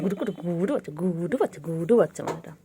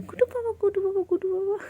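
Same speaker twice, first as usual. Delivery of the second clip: squeaky high coo to a pet; 11 semitones higher than usual. Real, not acted. Cooing at pet. (উঁচু গলায় আদুরে ডাক)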